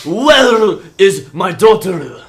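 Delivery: loud, drawn-out fatherly bellow; demanding, stretched-out shout; angry yell. Acted. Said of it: "he yells out".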